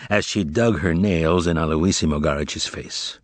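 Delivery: usual speaking voice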